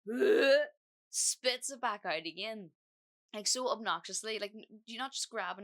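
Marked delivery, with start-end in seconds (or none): 0.1-0.6 loud, high disgusted blech; retelling his rude spit-out noise; mock gag. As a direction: spit it out with a loud gross "bleh". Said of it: "He goes".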